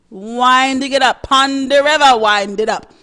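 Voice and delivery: lightly put-on Trinidadian lilt, winding it up; Trinidadian accent. Host slipping into Trinidadian accent describing carnival dancing. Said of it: "Why did I just go into some sort of dialect".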